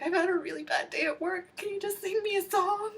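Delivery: high, tearful pleading whine; whiny plea. Pretend. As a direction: beg like a worn-out kid after work. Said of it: "It's like".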